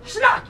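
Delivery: loud, high snap sound; shouted sound of snapping elastic. Retold or imitated. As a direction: mouth the snap of a released rubber band.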